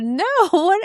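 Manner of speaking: high laughing no what; laughing disbelief. Retold or imitated. Telling the boss she isn't upset, laughing in disbelief. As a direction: laugh off the boss's question, high and amused. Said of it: "And I laughed".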